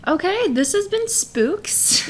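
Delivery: slow, high spooky sign-off; spooky announcer. Pretend. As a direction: draw it out like a ghost story host.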